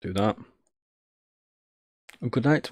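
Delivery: normal talking voice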